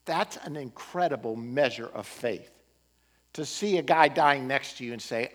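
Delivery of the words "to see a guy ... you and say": ordinary talk, nothing marked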